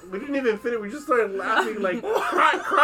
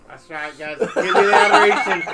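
laughing mock brag; 8 dB louder than usual. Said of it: "I was like".

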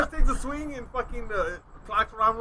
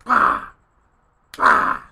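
loud, deep "bah" thud; imitated wall pounding; 8 dB louder than usual. Storyteller mimicking someone pounding the wall, loud vocal thuds. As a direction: bang out each thud with the voice. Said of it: "I hear someone pounding the wall".

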